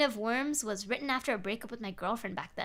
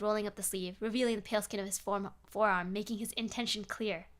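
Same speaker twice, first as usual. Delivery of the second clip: usual speaking voice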